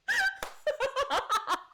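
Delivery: high squealing burst of laughter; giggling squeal. Real, not acted. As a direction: break into a delighted shriek of laughter. Laughing at own joke.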